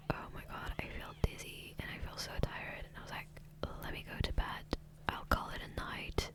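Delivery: usual speaking voice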